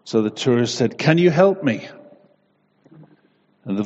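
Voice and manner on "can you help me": desperate plea. Acted. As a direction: beg for help hanging from a bush. Man dangling from cliff pleads.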